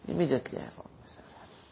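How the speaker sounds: a soft breathy whisper, quick. Shown as a demonstration. Preacher echoes the line to the congregation in a whisper.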